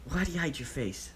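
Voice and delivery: put-on high little boy's question; curious child. A narrator's reading. Child Deidara suddenly asking Obito about his mask, high curious voice. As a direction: ask like a nosy kid. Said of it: "barrage of childish questions".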